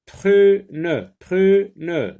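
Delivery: high, loud, clearly spoken dictation. Shown as a demonstration. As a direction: call the word out to the class. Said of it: "Deux syllabes".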